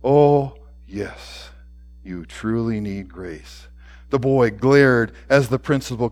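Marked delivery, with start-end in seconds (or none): 0.0-3.7 hushed, low and slow; whispered. A narrator's reading. Principal studies the boy's face, then speaks in a hush. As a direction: sink to a near-whisper with pauses. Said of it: "he whispers".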